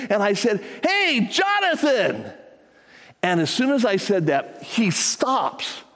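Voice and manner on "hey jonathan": high, drawn-out call across the field. Retold or imitated. Grandpa calling to his grandson across the picnic, a high drawn-out call. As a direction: call his name from far off, slowly.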